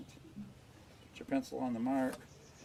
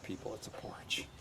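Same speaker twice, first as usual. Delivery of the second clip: muttered under the breath; quiet aside; 3 semitones lower, 9 dB quieter than usual. Real, not acted. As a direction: drop to a soft mutter.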